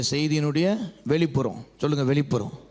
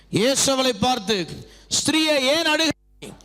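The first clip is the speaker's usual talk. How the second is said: raised voice with long drawn-out words; scripture proclamation; 7 semitones higher, slower than usual. Acted. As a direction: declaim the questions slowly to a congregation. Declaims Jesus' words from scripture. (வசனத்தை இழுத்து முழங்கும் பிரசங்க நடை)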